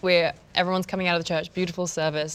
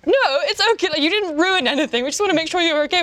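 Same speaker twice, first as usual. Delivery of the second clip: higher, louder eager reassurance; reassuring; 11 semitones higher, 5 dB louder, faster than usual. Retold or imitated. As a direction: soothe her quickly. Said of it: "And I was like".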